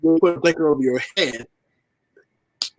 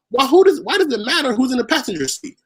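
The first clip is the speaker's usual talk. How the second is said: rising, exasperated disbelief, higher; indignant inner outburst; 7 semitones higher than usual. Retold or imitated. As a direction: demand why it even matters. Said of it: "I said this in my head".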